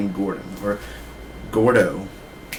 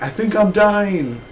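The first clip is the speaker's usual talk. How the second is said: much higher, slow, melodramatic; dramatic Gordon; 9 semitones higher, slower than usual. Retold or imitated. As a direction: wail it like a dying man. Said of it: "he's like".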